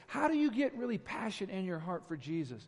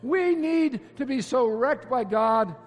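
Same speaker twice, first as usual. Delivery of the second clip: louder, higher fervent preaching; full-force preaching; 4 semitones higher, 8 dB louder than usual. Real, not acted. Pastor exhorting his congregation, fervent loud preaching. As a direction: preach it out with rising urgency.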